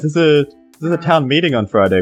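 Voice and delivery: normal talking voice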